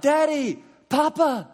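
put-on high, loud childlike cry; desperate child's call. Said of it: "you initially cry out".

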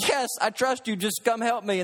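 mock-exasperated begging, lightly acted; desperate pleading. Man hanging from branch begs for help, mock desperate pleading.

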